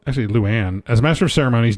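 ordinary delivery

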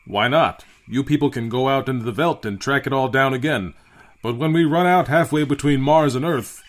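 captain overruling an objection at volume; argumentative captain. A narrator's reading. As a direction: argue back forcefully. Voices the captain arguing.

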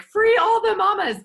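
high, loud, slow chant; rallying cry. Retold or imitated. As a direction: shout it like a slogan. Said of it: "we are always like".

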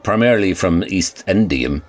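ordinary delivery

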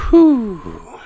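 high, loud whoosh of relief; big relief sigh. Retold or imitated. Owner alone after the police leave, high relieved whew. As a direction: let it all out in one high whew. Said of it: "and I'm like".

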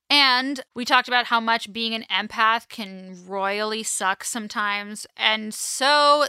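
ordinary talk, nothing marked

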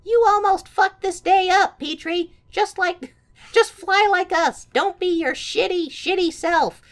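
high, loud, snotty sibling voice. Retold or imitated. Siblings scolding Petrie in a high, snotty voice. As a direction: sneer at him like a smug older sibling. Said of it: "his siblings are like".

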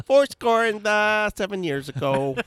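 put-on loud, high Chicago-accent Lincoln. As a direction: boom the Gettysburg opening, Chicago style. Joke Lincoln speech in accent.

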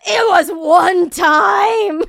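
real outrage at being misjudged, yelled slowly; exasperated shout. Real, not acted. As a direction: slowly yell it out in disbelief. Outraged at boyfriend's exaggeration.

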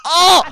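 loud, high "Oh!" of surprise; sharp loud comic gasp of shock; mock startled yelp. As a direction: yelp as if suddenly poked. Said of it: "Is the sound he makes as it goes in".

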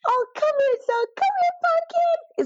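very high, loud cooing pet voice; really high pitched. Shown as a demonstration. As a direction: coo it up high like to a puppy. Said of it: "It's really high pitched".

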